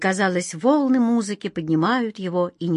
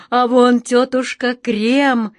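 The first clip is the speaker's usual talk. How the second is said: excited; 4 semitones higher, 6 dB louder, slower than usual. Acted. Pointing out joyfully.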